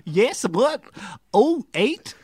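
attempted Canadian accent. Shown as a demonstration. Guest asked to do Canadian, badly faked Canadian accent. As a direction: have a shaky go at sounding Canadian. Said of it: "Can you do Canadian?".

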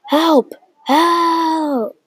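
high, drawn-out cry for help; yelling for help. Acted. Trapped storyteller calls out for rescue, high acted yell. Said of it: "I start yelling".

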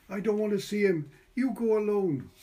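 high, loud frightened plea; a girl who can't face him; upset, near tears. A narrator's reading.

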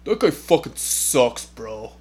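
half-serious heckle; mock trash talk. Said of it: "imagine me going in, going".

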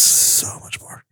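slow, drawn-out whisper; hushed, stretched-out aside; mock-weary whisper. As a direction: whisper it slowly as a trailing echo. Whispered echo of own remark.

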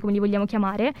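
usual speaking voice

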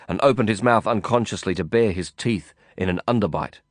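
plain reading voice

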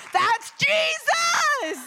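high, loud, slow exultant cry; joyful shout. Real, not acted. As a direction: shout the praise out. Joyful exclamation after healing.

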